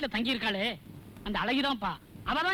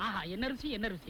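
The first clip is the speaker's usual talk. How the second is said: relishing; 5 semitones lower, 7 dB quieter than usual. Acted. Savouring idli alone.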